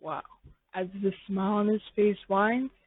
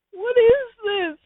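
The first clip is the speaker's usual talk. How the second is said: very high, slow wailing complaint; high slow crying whine; 11 dB louder, slower than usual. Acted. The listener moping alone in a slow high wail. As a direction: whimper it out slowly.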